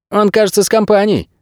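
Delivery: high-pitched servant character voice; obliging servant. A narrator's reading. Servant adding a warning for the stranger, obliging. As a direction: offer the detail in a lifted, high voice. Servant adds after a hesitation.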